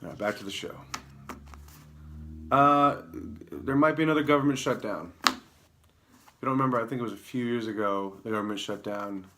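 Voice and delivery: ordinary talk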